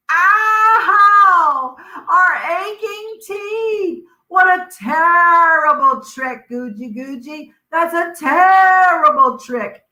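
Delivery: high, loud pained wail with a cry in it; wailing hurt crocodiles. Acted. Crocodiles biting the rock wail at Guji Guji in pain. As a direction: howl over aching teeth, sing-song in misery.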